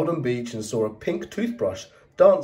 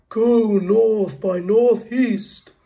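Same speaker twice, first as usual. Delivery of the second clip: very slow, higher and louder star; 9 semitones higher, 5 dB louder, much slower than usual. Acted. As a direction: stretch each word like a distant star. Star gives directions.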